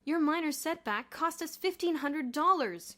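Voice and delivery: high, loud, slow anguished cry; cried in agony. A narrator's reading. Boots complains to George about lost money, anguished. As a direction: wail it in agony. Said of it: "cried Boots in agony".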